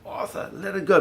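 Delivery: high urgent yell of a retold warning; retold shout. Father yelling to Arthur to release the cable, high shout. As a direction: call out sharply, pitched well up. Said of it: "my father shouts to".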